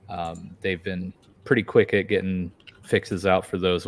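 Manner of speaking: ordinary talk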